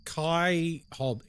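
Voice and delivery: ordinary talk, nothing marked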